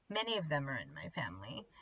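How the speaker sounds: breathy whisper aside; whispered confession. Real, not acted. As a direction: drop to a secret whisper.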